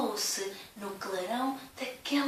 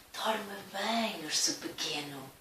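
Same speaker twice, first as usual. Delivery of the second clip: low, soft, tender; tender goodnight; 3 semitones lower, 5 dB quieter than usual. Acted. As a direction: tuck him in gently. Big bear says goodnight. (voz baixa e terna)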